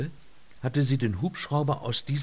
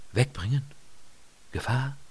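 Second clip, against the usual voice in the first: slightly questioning, word by word; pondering; 3 semitones higher, 4 dB quieter than usual. A narrator's reading. Lynott thinks over the ranger's two words, pondering. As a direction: mull over each word, pausing between. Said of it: "Sie sann nach über diese".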